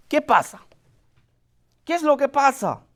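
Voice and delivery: high, urgent questioning call; anxious blind beggar asking. Acted. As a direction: call out to the crowd, eager to know. Blind Bartimeo hears the crowd.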